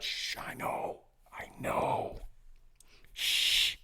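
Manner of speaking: mock-secretive whisper; very soft, voiceless hushing. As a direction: shush them, then whisper it as if forbidden. Mock hushing, can't talk about it.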